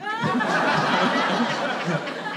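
high, rolling laugh; helpless laugh at own answer. Real, not acted. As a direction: laugh at yourself. Laugh alone on line.

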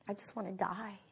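very quiet, low, deadpan misery; gloomy. Retold or imitated. Depressed woman opposite her cheery friend, gloomy. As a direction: drop it almost to nothing, low and glum.

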